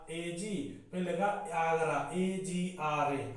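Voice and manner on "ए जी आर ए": ordinary delivery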